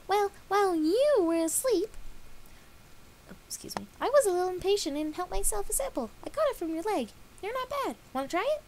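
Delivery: high, bright offering voice; cheery Pinkie. A narrator's reading. Pinkie offering Dash a sample, high and loud. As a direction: sweetly offer a horrid snack.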